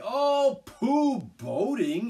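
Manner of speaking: loud, high scornful pooh; disgusted dismissal. A narrator's reading. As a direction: scoff at the very idea. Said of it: "in great disgust".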